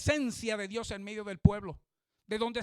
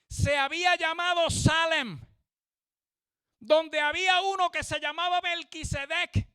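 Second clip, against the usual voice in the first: fervent pulpit preaching; full-force preaching; 9 semitones higher, 6 dB louder than usual. Acted. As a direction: proclaim it to the whole congregation.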